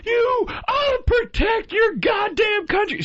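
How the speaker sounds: very high, slow shrill yelling; mock shout. Imitating the military lady yelling at him. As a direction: ham up her screaming lecture. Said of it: "she starts going".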